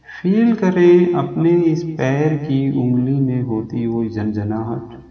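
soothing yoga nidra guidance; guided meditation. Acted. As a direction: talk them gently down into rest. Leads a yoga nidra relaxation.

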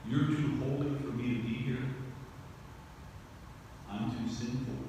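soft imagined confession, lightly voiced; hushed inner voice. Voicing a sinner's thought to Jesus, hushed. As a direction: murmur it like a private thought.

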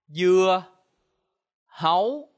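"Dưa hấu" high and loud, spaced word by word; Vietnamese pronunciation. Shown as a demonstration.

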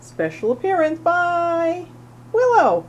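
excited announcement. Real, not acted. Announcing kitten's cameo.

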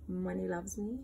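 ordinary talk, nothing marked

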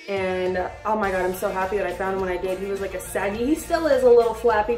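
normal talking voice

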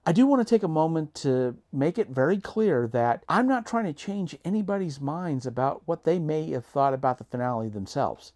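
ordinary delivery

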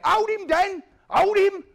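loud, high urgent shout; shouting for help. Retold or imitated. Victim yelling to Dan for help, loud urgent shout. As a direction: yell it out to your mate mid-fight. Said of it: "he's going".

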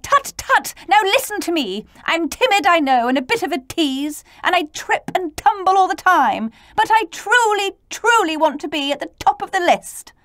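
high, loud, eager character voice; eager little T. Acted. Timid T trotting over to ask for first place, eager. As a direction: chatter up high, pleading earnestly.

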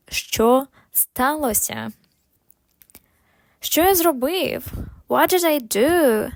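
ordinary delivery

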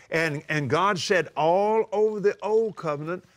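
normal talking voice

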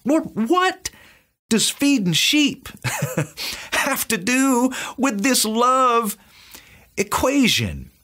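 high, bemused question to the Lord; lightly incredulous high voice; puzzled wondering. Retold or imitated. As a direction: act baffled, half laughing. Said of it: "And I thought".

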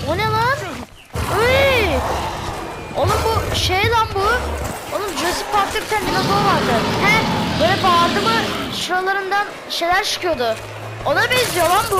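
loud, high, startled yelling; real shout of surprise, high and loud. Real, not acted. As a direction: yell out at the monster. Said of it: "o yüzden ben de bağırdım".

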